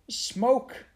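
high, rising cry; frightened exclamation. Acted. Mary Joe balking at the smoky stairs, frightened.

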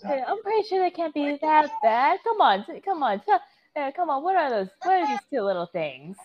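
high, gentle coaxing; coaxing character voice. Acted. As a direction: coax it out sweetly.

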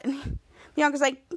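ordinary delivery

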